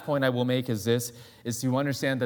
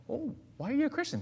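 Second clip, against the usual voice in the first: very high, softer put-on voice; high curious question; 12 semitones higher, 5 dB quieter than usual. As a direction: ask with wide-eyed curiosity. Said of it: "the person comes up and says".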